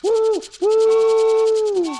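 high, loud woo like a steam whistle; train whistle. Acted. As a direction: hoot like a locomotive. Husband imitating train whistle.

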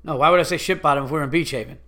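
fast, mocking imitation of the clerk; mimicking his fast talk. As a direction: throw his rushed words back at him. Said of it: "the way he was like".

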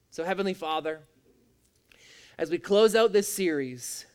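closing prayer. Acted. Pastor praying over the church to close the series, earnest. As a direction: lift it up as a sincere prayer.

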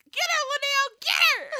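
high, loud shout egging a friend on; retold yelling. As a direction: cheer her on in a high-pitched holler. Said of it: "And I'm like".

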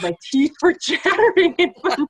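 high-pitched telling through laughter; laughing. Real, not acted. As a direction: let real laughter break up the words. Laughs recalling freezing on stage.

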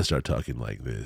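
dropped way down into a deep radio voice; real low voice. Retold or imitated. Imitating the friend's deep, low radio voice. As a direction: friend showing off how low he'll talk for the show. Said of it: "I'm gonna get real low like that".

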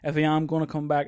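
usual speaking voice

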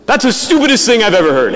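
gruff priest shouting down a student's plan; loud blunt put-down. Retold or imitated. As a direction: blast the verdict at him, no softening. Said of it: "very loudly".

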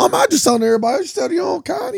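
high, fast, shouting character voice; shouted high-pitched retort; mock heated argument. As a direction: fire it back like a street argument. Acting out a movie argument.

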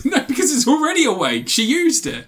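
high, laughing protest; incredulous laughter. Real, not acted. Reviewer arguing a plot hole with co-host, laughing incredulously. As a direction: object through real laughter.

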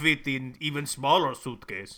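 pleased Russian spy logic; mock Russian accent. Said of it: "honestly pretty good accents".

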